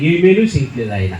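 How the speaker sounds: imitating the woman's sulky complaint. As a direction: grumble it as a flat, dropping statement. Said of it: "ఓసారి అన్నాడు".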